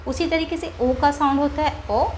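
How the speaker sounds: normal talking voice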